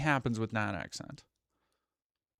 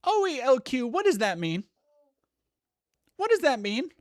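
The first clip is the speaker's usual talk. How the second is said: incredulous, much higher and louder; baffled outrage; 12 semitones higher, 5 dB louder than usual. Real, not acted. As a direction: demand an answer in disbelief. Puzzling over a chat message.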